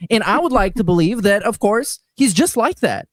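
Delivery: ordinary delivery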